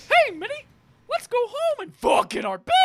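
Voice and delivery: very high falsetto cartoon mouse; Mickey Mouse. Showing the real bit to a friend, squeaky mouse impression. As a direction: squeak it right at the top of the voice. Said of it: "The real one was".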